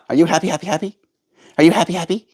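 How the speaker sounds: high cooing pet talk. Acted. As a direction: coo at a little bird.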